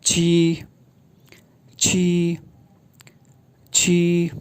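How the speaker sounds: छी said with an aspirated ch and a long ee; छी with a puff of air; छी like the Mandarin syllable qi; pinyin qi. Shown as a demonstration. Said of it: "क्यू की sound".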